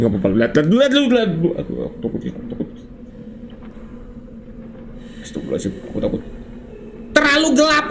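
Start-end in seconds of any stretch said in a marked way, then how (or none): 1.6-6.2 whispered, much softer, frightened; scared whisper. Real, not acted. Gamer in the dark hole whispers to viewers, scared. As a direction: breathe it out, too scared to speak up.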